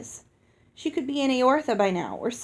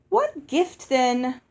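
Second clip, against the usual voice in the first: lady fairy; 3 semitones higher, 4 dB louder, much slower than usual. A narrator's reading. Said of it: "the other lady fairy asked".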